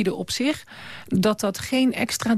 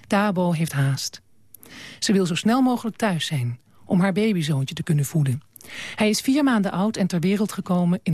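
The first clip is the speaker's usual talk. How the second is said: measured literary reading with deliberate pauses; story narration; 4 semitones lower than usual. A narrator's reading. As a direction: read the book aloud gravely, phrases falling lower. Leest voor uit boek. (verhalend voorlezen, beheerst en met pauzes)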